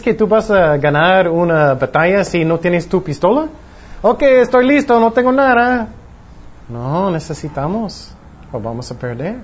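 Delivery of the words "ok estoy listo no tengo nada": high, naive eagerness for battle; mock readiness. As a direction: play someone cluelessly ready to fight. Acts unarmed person going into battle. (entusiasmo ingenuo fingido, con voz aguda)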